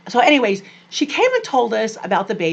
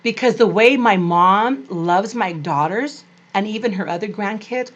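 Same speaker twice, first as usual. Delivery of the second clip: ordinary talk, nothing marked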